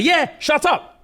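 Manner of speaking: high, drawn-out mock yell; mock angry retort. [name] shouting back at Lucian, mock angry. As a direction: throw it back hotly, as storyteller.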